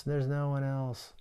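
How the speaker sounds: soft, defeated, trailing off; the scream collapsing into a quiet hopeless echo; faded despair. Retold or imitated. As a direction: let it die away to a broken murmur.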